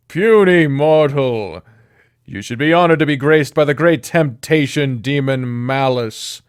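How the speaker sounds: loud, grand demon voice; pompous demon snake. Acted. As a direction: boom it out like a vain demon.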